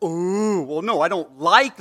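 loud mock crush-teasing and backpedalling; schoolkid protest. Grade-schooler denying a crush to classmates, loud protest.

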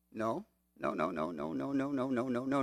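low, soft string of rapid noes; quiet dismissal. Real, not acted. Preacher rejecting the ego idea, quiet dismissal. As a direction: wave the idea away under your breath.

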